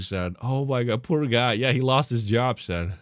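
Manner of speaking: normal talking voice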